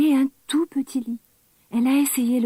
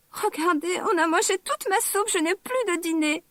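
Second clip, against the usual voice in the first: much higher, tiny tearful little bear; baby bear's tiny voice; 7 semitones higher than usual. Acted. Baby bear finds his broken chair, tiny high tearful voice. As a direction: squeak it out like a tiny upset cub. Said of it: "a dit bébé ours en pleurant avec sa toute petite voix".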